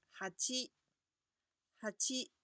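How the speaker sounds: 哈七 said as hachi, clear; Japanese hachi. Shown as a demonstration. Teacher modelling eight as Japanese hachi. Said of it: "八的读音是哈七".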